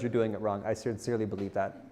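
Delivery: normal talking voice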